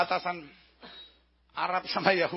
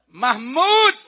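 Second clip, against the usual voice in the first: high, loud name call; acted shout to someone far off; 4 semitones higher, 13 dB louder than usual. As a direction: yell the name across the border. Enemy calls out a name again.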